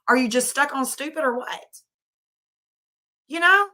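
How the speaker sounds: exasperated, rising to a high indignant "You know?"; fed-up outburst at the parents; genuinely worked-up scolding; real upset. Real, not acted. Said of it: "I'm getting so upset".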